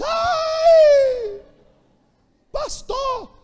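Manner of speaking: high shrieking cry of surprise; mock shriek. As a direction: shriek like the bride doused in juice. Bride hit with grape juice.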